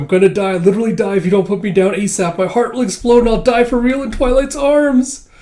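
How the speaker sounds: high, loud, climbing frenzy; hammed-up panic. Fiona flustered in Twilight's arms, hammed-up rising panic. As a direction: build to an over-the-top swoon of panic.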